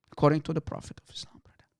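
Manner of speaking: whispered scornful aside. Real, not acted. Insulting the visitors to the audience in a whisper.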